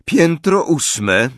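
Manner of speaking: high, slow lift announcement; slow, impersonal high female voice; cool female voice. A narrator's reading. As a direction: announce the floor as a cool woman. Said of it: "oznajmił chłodny kobiecy głos".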